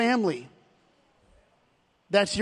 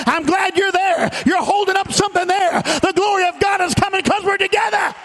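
climbing to a shouted, higher peak; preaching; 7 semitones higher, 5 dB louder than usual. Acted. Pastor rallying the congregation, shouting with rising fervour. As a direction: build the sermon to a roaring finish.

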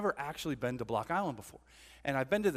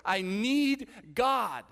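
high, loud, slow cry of need; drawn-out, acted pleading; desperate plea; 8 semitones higher, 5 dB louder, much slower than usual. Preacher voices psalmist in a valley, desperate plea. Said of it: "he's in such a hard time".

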